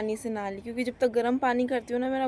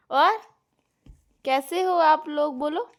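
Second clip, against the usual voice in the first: put-on baby talk; 5 semitones higher, 8 dB louder than usual. Coaxing baby to speak.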